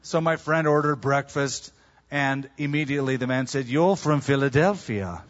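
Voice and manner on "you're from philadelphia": English accent imitation. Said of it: "the local guy".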